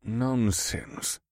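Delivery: soft dismissive man's voice. Acted. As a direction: brush it off lightly. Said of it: "in a dismissive tone".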